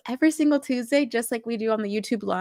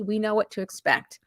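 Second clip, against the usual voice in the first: ordinary talk, nothing marked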